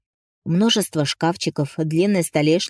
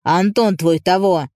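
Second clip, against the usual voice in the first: agitated; 4 dB louder, slower than usual. Acted. Agitated owner bursts in.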